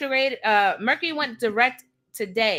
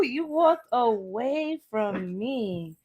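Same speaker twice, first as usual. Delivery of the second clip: very slow, softer, dragged-out repeat; drawn out; 4 dB quieter, much slower than usual. Shown as a demonstration. Reader repeating the card's message to viewers, very slowly. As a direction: stretch every word out knowingly.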